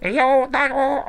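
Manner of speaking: high, slow muffled robotic impression; Dalek voices. Fan mocking the Dalek voices he dislikes, muffled impression. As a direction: talk as if into cupped hands. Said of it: "because of the Dalek voices".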